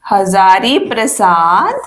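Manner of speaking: Hazari Prasad stretched out, very slow; slow dictation. Shown as a demonstration. Teacher to students taking notes, slow dictation. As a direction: draw out Hazari Prasad so students can write it. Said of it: "repeats the name slowly".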